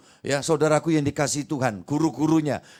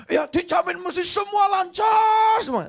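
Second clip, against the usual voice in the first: emphatic slow shout. Retold or imitated. Quoting overconfident believer.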